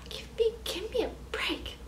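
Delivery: whispered. Real, not acted. Exasperated aside.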